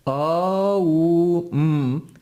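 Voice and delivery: "A, u, m" held as ah, oo, mm; separately intoned sounds. Shown as a demonstration. Teacher showing the parts of om, "A, u, m" sounded one by one. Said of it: "pronounced together becomes om".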